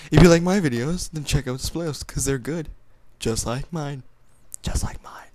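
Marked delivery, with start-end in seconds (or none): 4.6-5.3 breathy unvoiced whisper. Shown as a demonstration. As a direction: whisper it like a secret. Whispered repeat.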